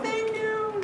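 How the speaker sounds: tiny high-pitched voice; imitating grateful producers in a small, high voice; mock thanks. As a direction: squeak it softly, far above normal. Producers' relieved thanks, retold.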